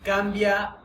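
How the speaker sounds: calm, unhurried guiding voice with long pauses; guided meditation. Acted. Teacher guiding students through a voice-tone exercise, meditative. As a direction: lead the group inward through the exercise, gently.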